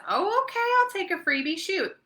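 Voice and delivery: high, bright mock-casual agreement; imitating a guest. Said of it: "and she says".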